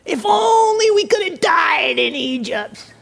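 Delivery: high, wailing mock lament; hammed-up grumbling; grumbling complaint. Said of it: "the whole community was grumbling".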